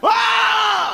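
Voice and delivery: high, drawn-out mock scream; mock shocked scream. Retelling first day in England, high mock scream. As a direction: let out a long, hammed-up cry of shock. Retold shock on learning meaning.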